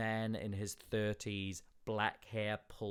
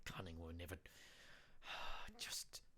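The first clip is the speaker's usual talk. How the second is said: 1 weary whisper; 4 semitones lower, 12 dB quieter than usual. Acted. Muttering despairingly.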